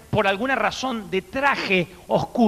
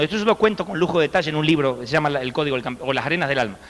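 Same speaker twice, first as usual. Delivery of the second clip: normal talking voice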